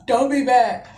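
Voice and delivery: loud, whimpering mock sob; ugly crying voice. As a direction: imitate bawling a confession to mom. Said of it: "do that ugly ass voice".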